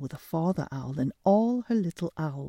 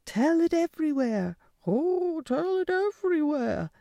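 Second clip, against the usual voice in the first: excited chorus; 11 semitones higher than usual. Acted. Hens spreading the tale.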